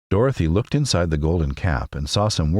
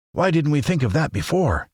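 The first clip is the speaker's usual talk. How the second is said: much higher, eager scarecrow cry; excited cry; 10 semitones higher than usual. A narrator's reading. Scarecrow reacting to Dorothy's plan, eager higher cry. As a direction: exclaim it, delighted by the idea. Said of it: "cried the Scarecrow".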